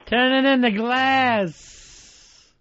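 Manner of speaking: drawn out, high and slow; slow drawn-out mock voice. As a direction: stretch it out like a mock announcer. Host mocks bombing idea, drawn out.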